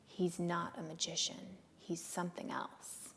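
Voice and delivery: quiet, understated stern correction. Retold or imitated. Friend correcting Claudia about the rabbi, quietly stern. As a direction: correct them softly but firmly, voice dropping away. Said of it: "she told me sternly".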